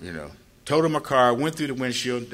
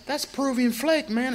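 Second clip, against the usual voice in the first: pitched way up, proud of the product; excited boast; 13 semitones higher than usual. Retold or imitated. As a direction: brag about the cocaine, voice jumping high. His own excited retort.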